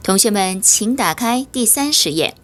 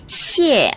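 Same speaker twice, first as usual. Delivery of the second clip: 谢 with a high, sharply falling fourth tone; 7 semitones higher, 3 dB louder than usual. Shown as a demonstration. Teacher shows the class the fourth tone, 谢 falling steeply. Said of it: "성조가 떨어지는 성조".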